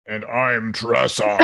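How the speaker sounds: slow put-on deep voice; forcing a deep voice. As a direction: fake a deep voice for laughs.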